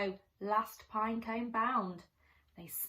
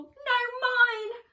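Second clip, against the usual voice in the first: high, loud holler; 13 semitones higher, 10 dB louder than usual. Acted. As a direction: bellow your claim right back at him. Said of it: "hollered Bruce".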